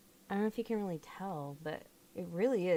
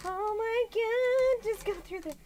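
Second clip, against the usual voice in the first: exasperated drawl; 14 semitones higher, 7 dB louder than usual. Real, not acted. Frustration drawn out.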